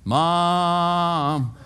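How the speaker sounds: long drawn-out call for Mom. Retold or imitated. Fifth grader in dark room to his mom, calling out. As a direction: imitate a scared child calling from bed. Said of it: "I started calling my mom".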